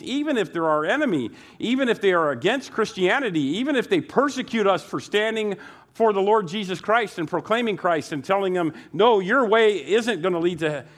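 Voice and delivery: loud, high, driving sermon delivery; fiery preaching. Acted. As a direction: preach it hard to the congregation. Pastor preaching at full force.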